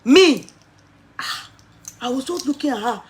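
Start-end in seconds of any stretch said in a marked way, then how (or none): 0.1-0.4 louder, rising disbelief; incredulous shout. Retold or imitated. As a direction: shout it in mock shock. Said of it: "I said".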